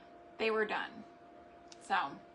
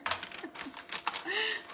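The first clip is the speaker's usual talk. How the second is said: high breathy laugh; breathless laugh; 11 semitones higher than usual. Real, not acted. Breathless laugh at the stuck paper. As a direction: let a silent giggle out.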